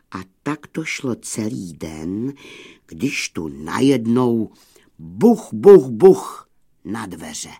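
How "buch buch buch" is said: loud, punched knocking sound words; sudden loud banging. A narrator's reading. Narrator imitates someone banging on the wolves' door, loud punched thuds. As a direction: pound out each knock with the voice.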